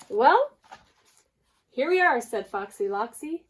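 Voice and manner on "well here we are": high, loud sly welcome; sly fox. Acted. Fox luring the birds into his den, high and loud. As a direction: announce the den like a cunning host.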